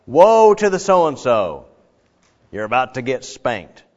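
slow, loud woe then gloat; mock doom. Preacher parodying a judgmental prophet, mock doom. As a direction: pronounce the punishment with relish. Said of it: "isn't that what you kinda think of".

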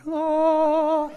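long high held "ohhh"; hammed-up gasp of wonder, drawn out; mock choir-like awe on one high note; awed "oh". Retold awe entering the office.

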